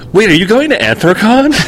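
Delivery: imitated surprise, pitched well up; surprised. Boss catching where the employee is going, surprised. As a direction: act out a boss's startled question. Boss spots convention on form.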